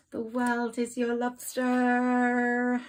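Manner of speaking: drawn-out, loud and high proclamation; slow theatrical declaration; grand announcement. Shown as a demonstration. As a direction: boom it out like a fanfare. Dramatic announcement of card phrase.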